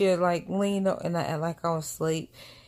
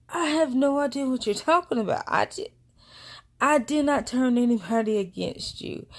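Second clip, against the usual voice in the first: indignant denial; 6 semitones higher, 3 dB louder than usual. Retold or imitated. Acted denial to accusing sister.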